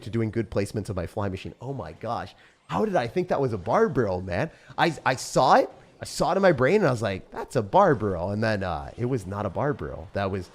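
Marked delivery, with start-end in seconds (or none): 2.7-8.3 loud disbelief. Real, not acted. Loud disbelief at own mistake.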